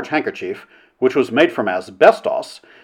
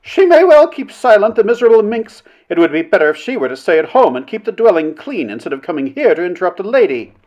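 haughty, scornful put-down; sneering; 7 semitones higher, 4 dB louder than usual. A narrator's reading. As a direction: pour scorn on the silent heckler. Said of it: "so Missus Blank sneered".

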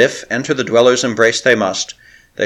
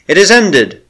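high, loud final verdict; crying out; 8 semitones higher, 5 dB louder than usual. Acted. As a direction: pronounce the end loudly, from on high. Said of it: "she cried".

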